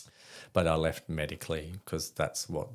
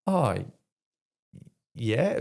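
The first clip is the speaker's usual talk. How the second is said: loud, higher OH, long pause, then YEAH; hesitant pause; 5 semitones higher, 5 dB louder than usual. Retold or imitated. Interviewee caught out by a job question, answering with a telling pause. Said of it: "a really interesting pause".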